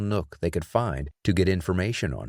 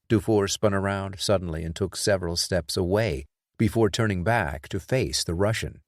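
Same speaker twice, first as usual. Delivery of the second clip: plain reading voice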